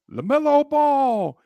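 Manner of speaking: high, drawn-out arena starter call; arena announcer. Retold or imitated. Announcing the starters to the home crowd, hyped arena call. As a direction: stretch the name out loud like a hyped announcer. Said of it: "Then they announced the starters".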